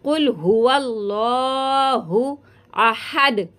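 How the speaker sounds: slow recitation with a heavy qaf in कुल. Shown as a demonstration. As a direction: recite slowly, qaf thick. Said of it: "इसको मोटा पढ़ेंगे". (मोटे क़ाफ़ के साथ तिलावत)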